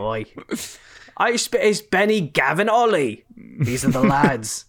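hammed-up British bloke listing his mates; joking about baby names in a mock British accent; British lad. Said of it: "such a British lad".

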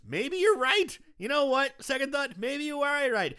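high, sly robot voice, pitched well up; Pino changing his mind. Retold or imitated. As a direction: play the killer doll suddenly agreeing. Pino turns, voiced in recap.

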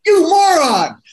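high, loud teasing jab; gleeful mock insult. Planning to needle columnist friend, loud mock insult. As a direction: crow it like a playful insult to a friend. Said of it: "I get to call Max Maven and say".

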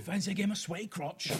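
a lighter Cockney repeat. Pretend. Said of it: "do it in a Cockney accent".

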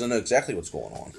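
drops low and soft, rattling it off fast; low conspiratorial Sammy. Retold or imitated. Imitating Sammy telling his customer he knows what's up, low and hushed. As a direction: hush it like a shopkeeper sharing a secret.

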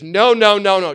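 loud, high run of refusals; emphatic objection. Acted. James stopping a complacent believer, loud emphatic objection.

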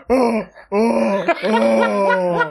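loud high mock demon laugh; demonic laugh. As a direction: imitate the creepy toy laugh. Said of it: "He just demonic laughed".

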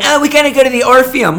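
high, quick mocking voice of a hurried diner; mock flustered guest. Server mocking a guest rushing to a show, high fast voice. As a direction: rattle it off like a panicked customer.

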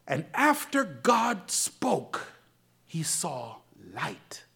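low, soft, very slow; preacher quietly spelling it out to the church; slow hushed preaching. Acted. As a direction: let each phrase sink in.

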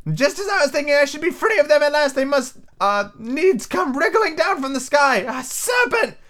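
very high, agitated bird shriek; shrieking pigeon. Acted. Pigeon lamenting the serpent from the sky, shrieking. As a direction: shriek it, dragging out the last words. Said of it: "raising its voice to a shriek".

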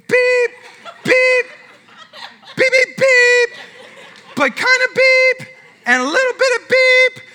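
very high, loud monotone beeps; piercing held beep noises; mock censor bleeps. Shown as a demonstration. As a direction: imitate a bleep tone over swear words. Voices censor bleeps for cuss words.

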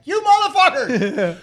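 high, loud, drawn-out mock scream; imitated scream. Retelling the wife's scream on the ride, loud and high. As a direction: scream it like she's being shot backwards. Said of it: "she starts screaming, and she's like".

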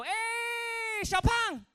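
high, loud, long drawn-out hollered greeting; barber's greeting. Retold or imitated. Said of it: "barber would always greet me the same way".

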